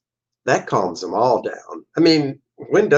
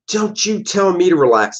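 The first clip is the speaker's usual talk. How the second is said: mock snap; 8 semitones higher than usual. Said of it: "she snaps back at him".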